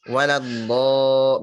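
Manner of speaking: waladdo slow with a full dark o; heavy waladdo. Shown as a demonstration. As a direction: draw out waladdo thick. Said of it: "misalkan contoh".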